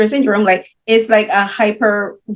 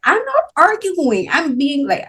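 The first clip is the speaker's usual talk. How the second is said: put-on teen protesting; 6 semitones higher than usual. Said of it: "we're like".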